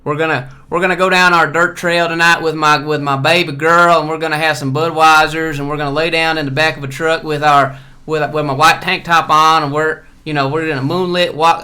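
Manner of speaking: exaggerated mock Southern accent; Georgia accent. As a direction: play a Georgia good ol' boy dreaming of the truck bed. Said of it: "I don't talk like that".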